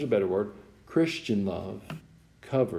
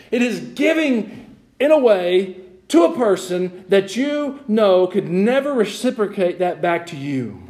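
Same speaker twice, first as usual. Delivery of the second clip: impassioned preaching; 10 semitones higher, 6 dB louder than usual. Real, not acted. Preacher telling congregation what generous love is, high fervent preaching. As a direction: drive each phrase home to the congregation.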